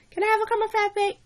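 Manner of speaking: put-on high, quick, pestering kid sister voice; nagging little sister. Little sister keeps begging for a frappe, nagging. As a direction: beg again, pushier, like a kid sister. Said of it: "my sister is nagging me the whole time".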